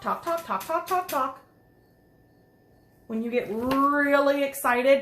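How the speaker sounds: plain reading voice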